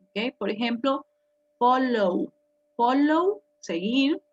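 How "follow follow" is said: "follow" ending in a long, open O-U glide; teacher modelling the vowel ending of "follow"; final vowel sound. Shown as a demonstration. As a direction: draw out the last vowel of "follow", louder. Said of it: "termina en un sonido de vocal".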